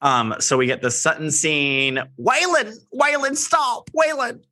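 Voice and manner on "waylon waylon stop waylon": high-pitched mimicked scolding call; calling the dog. Acting out the scene, calling the dog.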